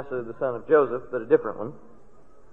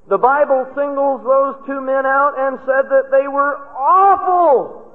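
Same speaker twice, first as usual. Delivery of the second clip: slow, high and loud pulpit denunciation; preaching at full force; 8 semitones higher, 4 dB louder than usual. Acted. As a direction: thunder out the sins of the fathers.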